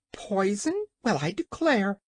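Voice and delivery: high, offended hen; indignant. A narrator's reading. Hen affronted by the King's insult, indignant. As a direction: pipe up in indignation. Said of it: "said Billina indignantly".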